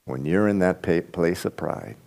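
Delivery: quiet confession. Real, not acted. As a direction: confide it like a personal admission. Pastor confessing personal pride.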